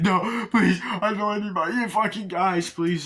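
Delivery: high, loud pleading; imitated panicked begging. The cop begging not to lose his ear, high and frantic.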